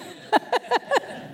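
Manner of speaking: breathy laugh. Acted. Laugh after the voiced quote.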